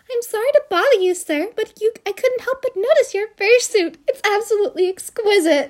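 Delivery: high, fast polite gushing; a hint of a flustered admirer; lightly voiced flirty woman.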